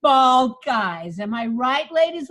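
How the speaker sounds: attitude. Shown as a demonstration. Coach demos a bald joke with swagger. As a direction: own the baldness with swagger. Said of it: "find some advantages".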